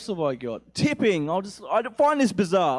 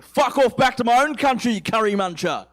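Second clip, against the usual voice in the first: loud, higher-pitched mock abuse; angry heckler; 6 semitones higher, 6 dB louder than usual. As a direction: yell it like a hostile local. Voicing racist abuse from Australians.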